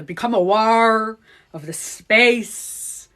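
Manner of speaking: very slow, loud meditation drone; exaggerated stretched-vowel guru voice; Joe Dispenza. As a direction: drag each key word out like a hypnotic guide. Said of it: "that's what he was saying".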